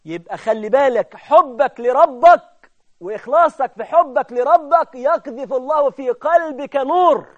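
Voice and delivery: loud, slow, emphatic exhortation; impassioned preaching. Real, not acted. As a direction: drive it home to the congregation, drawn out. Preaches at full force.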